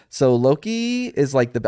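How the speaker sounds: ordinary talk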